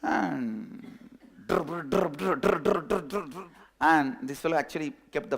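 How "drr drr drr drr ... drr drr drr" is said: phone ring. Retold or imitated. Phone ringing again.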